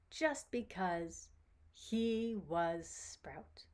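slow, deliberate storyteller's close; storybook ending. A narrator's reading. Storyteller ending Sprout's tale, slow read-aloud close. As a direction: draw out the last phrases for a child.

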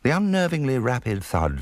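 plain reading voice

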